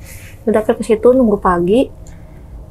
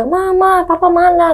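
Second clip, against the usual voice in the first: put-on high frightened child's cry for papa; scared child calling; 8 semitones higher, slower than usual. Frightened child calls for papa at night, high scared cry. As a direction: call out for papa like a terrified little kid. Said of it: "Gue teriak, karena gue takut".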